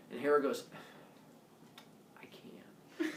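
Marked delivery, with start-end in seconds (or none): 2.2-3.1 whispered threat, barely voiced; menacing whisper. Hera threatening Leto, a whispered menace. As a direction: breathe the threat ominously.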